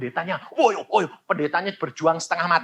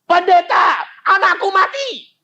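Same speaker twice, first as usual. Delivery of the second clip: loud, high cry of grief; mother's scream; 9 dB louder than usual. Retold or imitated. Grieving mother screaming to the pastor, loud cry. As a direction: wail it at the pastor. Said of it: "dia teriak".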